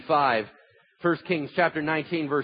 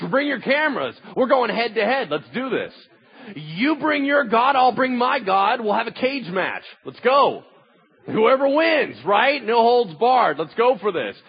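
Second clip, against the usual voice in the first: bold challenge; 6 semitones higher, 5 dB louder than usual. Acted. Elijah challenging the rival prophets.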